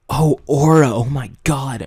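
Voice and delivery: playfully overdone exclaiming; mock exclamation. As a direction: exclaim it like a silly catchphrase.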